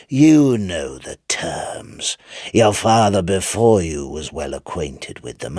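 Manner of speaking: devil bargaining. Acted. Devil coaxing Chips to strike the bargain, slow and sly. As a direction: draw him into the bargain slowly and slyly.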